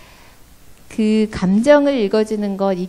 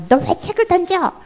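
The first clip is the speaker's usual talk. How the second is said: high, sharp scolding mother voice; 11 semitones higher than usual. Retold or imitated. Mom scolding child for throwing a book, mock angry. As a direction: imitate an irritated mom scolding. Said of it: "막 이렇게 하는 거".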